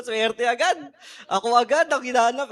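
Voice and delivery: laughing through the words, pleased at the luck; laughing amused disbelief. Real, not acted. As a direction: chuckle while you brag about your luck. Laughing at his own luck.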